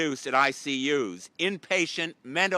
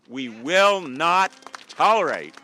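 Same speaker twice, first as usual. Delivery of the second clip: loud; 6 dB louder than usual. Real, not acted. Rally speech building to a shout.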